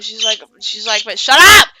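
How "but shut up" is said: sudden loud, high yell; acted outburst, shouting someone down; tweaking out. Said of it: "she's tweaking out".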